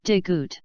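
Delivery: "digut" said with a light D and a short, clipped final T; name the letter "digut" the way a teacher would; Korean letter name. Shown as a demonstration. Teacher naming a Korean consonant for the class, careful pronunciation. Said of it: "is called".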